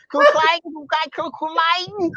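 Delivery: high, ranting fake German; mock Hitler rant. Comedian mimics angry German bus driver, Hitler-like rant. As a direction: imitate a dictator tirade in mock German. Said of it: "it was like Hitler came back".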